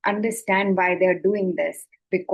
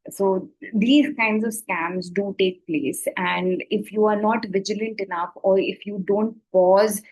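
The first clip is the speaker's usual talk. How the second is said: usual speaking voice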